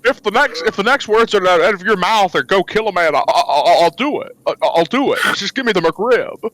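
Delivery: stammering, desperate addict in character; Jimmy Stewart. Acted. Jimmy confessing a McRib addiction, stammering desperately. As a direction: stutter through a hammed-up confession. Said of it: "I'm Jimmy Stewart".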